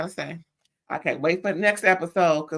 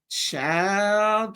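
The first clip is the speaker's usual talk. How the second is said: usual speaking voice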